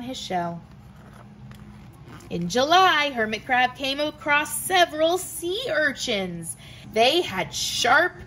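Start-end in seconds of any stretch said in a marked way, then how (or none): none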